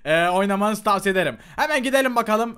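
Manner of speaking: usual speaking voice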